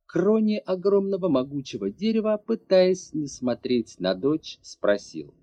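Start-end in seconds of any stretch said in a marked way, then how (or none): none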